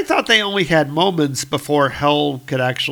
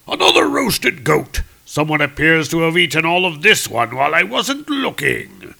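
booming viking; 4 semitones higher, 4 dB louder than usual. Acted. Hungry giant Volstagg demanding food.

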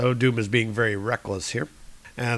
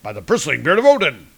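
louder, fast heroic exclamation; hearty oath; 2 semitones higher, 7 dB louder, faster than usual. Acted. Thor deflected by the sphere, exclaiming loudly. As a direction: swear by Odin in surprise. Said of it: "And Thor's like".

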